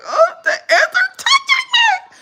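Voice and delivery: shrieked mock panic, very high and loud; crashing out. Storyteller reliving ants crawling on her, high panicked wail. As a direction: squeal it out slowly in exaggerated horror. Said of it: "So I'm crashing out".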